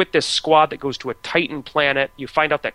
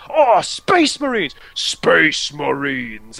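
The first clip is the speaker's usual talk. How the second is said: Cockney orc shout; much slower than usual. Retold or imitated. Space orcs charging the player, shocked Cockney yell. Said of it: "sounding very shocked and excited".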